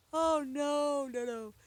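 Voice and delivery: high, loud mock-panicked "oh no"; mock dismay. Storyteller acting out being in trouble, mock dismay. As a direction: wail it high in comic alarm. Retelling their own alarmed reaction.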